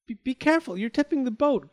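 high, loud, stammered warning; alarmed cry. A narrator's reading. Girl warns him the boat is tipping, crying out. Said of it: "cried the girl".

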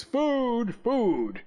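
calling out high, Food first; shouting. Acted. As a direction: shout it across the hall. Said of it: "shouted at the top of his voice".

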